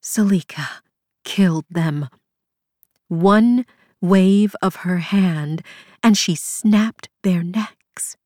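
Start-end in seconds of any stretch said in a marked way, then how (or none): 0.0-2.1 pained, rasping voice; painful rasp. A narrator's reading. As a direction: rasp it out in pain. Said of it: "her words came in a painful-sounding rasp".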